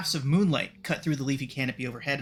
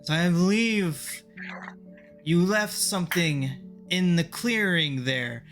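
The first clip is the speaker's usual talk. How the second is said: talking tree; 4 semitones higher, slower than usual. Acted. Tree voiced by game master.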